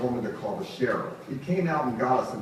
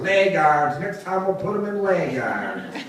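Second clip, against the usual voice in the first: yelling; 6 semitones higher, 6 dB louder than usual. Retold or imitated. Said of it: "was yelling".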